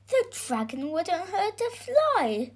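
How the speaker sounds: put-on high, earnest boy's voice; defending boy. A narrator's reading. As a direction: plead the dragon's case as the boy. Boy defends the dragon to George.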